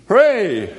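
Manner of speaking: a loud playful hooray; mock cheer. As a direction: cheer like the glad Christians.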